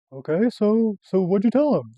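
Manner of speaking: high, loud mock panic; shaking. College kid to mother about the sheriff, panicked. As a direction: blurt it out in exaggerated alarm. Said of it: "just, like, shaking".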